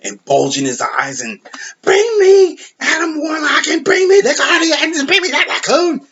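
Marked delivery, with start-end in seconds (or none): 1.8-6.0 hammed-up villain shriek, much higher and louder; mocking a buffoonish villain screaming at his minions; over the top screaming. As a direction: bellow orders like a cartoon tyrant. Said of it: "he's just doing over the top screaming".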